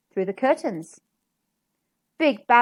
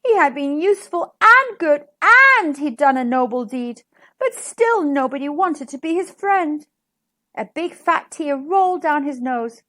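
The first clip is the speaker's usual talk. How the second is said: usual speaking voice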